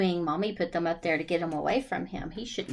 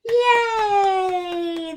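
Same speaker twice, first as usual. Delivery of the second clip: cheer; 10 semitones higher, 8 dB louder than usual. Real, not acted. Cheer after song.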